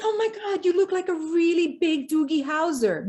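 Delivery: high, excited gasp then gush; starstruck gasp. Retold or imitated. Starstruck meeting Tim Robbins.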